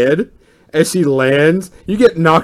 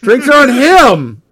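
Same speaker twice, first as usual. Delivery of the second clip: high, loud, drawn-out call; gleeful announcement; 8 semitones higher, 5 dB louder than usual. Acted. [name] sticking the loser with drinks, gleeful shout. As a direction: crow it to the whole bar.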